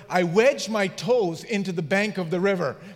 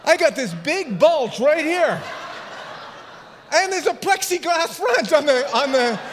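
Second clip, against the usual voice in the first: laughing; 8 semitones higher, 4 dB louder than usual. Real, not acted. Pastor laughing at his own story.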